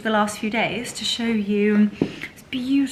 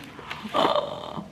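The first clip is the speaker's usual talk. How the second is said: gasp. Real, not acted. Opening gift.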